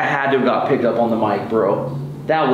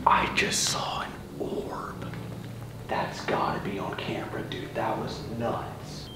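hushed real whisper, almost no voice; 12 dB quieter than usual. Real, not acted. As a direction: whisper it so the ghost won't hear. Quiet orb sighting on camera.

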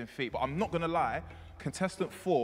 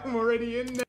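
laughing; 9 semitones higher, 5 dB louder than usual. Real, not acted. Laughs, cocky.

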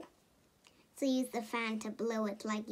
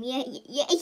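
ordinary delivery

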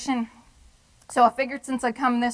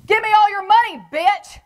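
very high, loud thug shout; louder robber's demand; 11 semitones higher, 9 dB louder than usual. Retold or imitated. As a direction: yell it harder, like the punk lost patience. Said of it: "and says to me".

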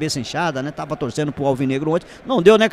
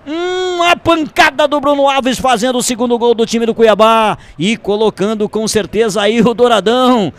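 roaring, drawn-out goal call, much louder and higher; explosive goal commentary; 9 semitones higher, 12 dB louder, slower than usual. Real, not acted. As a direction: shout the goal like a radio announcer at full force. Live radio goal call.

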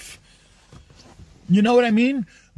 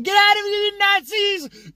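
mocking feminine voice; 8 semitones higher than usual. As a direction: make fun of someone trying to sound strong. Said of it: "a voice that sounds like that".

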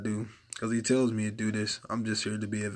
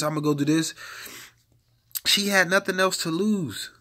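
higher, louder fervent preaching; preaching at full force; 7 semitones higher, 7 dB louder than usual. Acted. Preacher urging listeners to try Jesus, fervent shouting. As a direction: build to a shout and hold it.

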